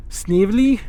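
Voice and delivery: thin, high test voice for a goofy rat; trying a sniveling rat voice. Shown as a demonstration. Vlogger testing a rat voice for his campaign, high and sniveling. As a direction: try out a sneaky rat squeak. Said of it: "what kind of rat sound?".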